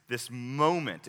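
moment drawn out slowly and leaned on; slow down and weigh the word moment; stress on moment. Shown as a demonstration. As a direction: moment said slowly and deliberately with heavy emphasis. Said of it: "I stress the word moment".

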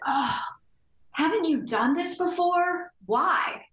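imitating a dismissive doctor, high and slow; exasperated doctor. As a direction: drag it out with a condescending, weary sigh. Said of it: "this big exasperated sigh".

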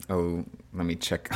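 ordinary talk

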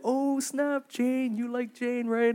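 high mocking "oh snap" tease; ribbing a buddy about his crush, up high; teasing. Boys teasing a friend about his crush, high mocking tease.

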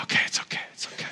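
soft, whispered soothing; hushed reassurance. Retold or imitated. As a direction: imitate a parent whispering to calm a child. Retold soothing of upset son.

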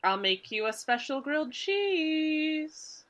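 tempting them with a treat, drawn out; coaxing. Acted. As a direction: sweet-talk them slowly and softly. Sunflower coaxing in character.

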